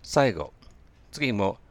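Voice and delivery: ordinary delivery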